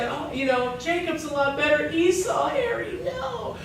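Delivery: high, soft mock girlish voice; imitating a girl. Girl weighing up the two brothers, imitated in a high voice. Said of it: "she's like".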